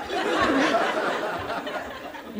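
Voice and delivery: soft, high, airless laugh; breathy laugh. Real, not acted. Laughing while recalling the show, real chuckle. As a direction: let out a quiet real laugh.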